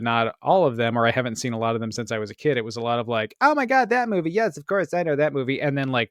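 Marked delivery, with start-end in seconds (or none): 3.4-5.6 high start, settling as it goes; mock gasp of recognising an old favourite; delighted recognition. Viewers recalling movies they had seen, delighted recognition.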